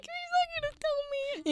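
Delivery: nervous high-pitched self-quote; high anxious inner voice. Retold or imitated. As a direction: squeak it like a worried thought. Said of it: "I was like".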